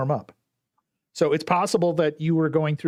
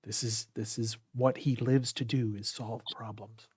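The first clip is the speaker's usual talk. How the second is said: golf commentator; 7 dB quieter than usual. Acted. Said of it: "my golf voice".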